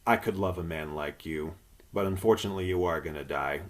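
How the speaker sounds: soft, hushed words of comfort; tender farewell. Retold or imitated. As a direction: soothe a doomed pilot over the radio.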